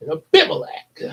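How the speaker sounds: Abimelech punched out high; emphatic name. Shown as a demonstration. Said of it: "You say that name with some emphasis".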